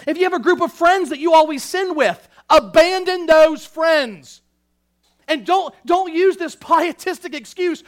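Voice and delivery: high, loud, urgent exhortation; fired-up pulpit warning; forceful preaching. Real, not acted. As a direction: preach it hard, punching the key commands.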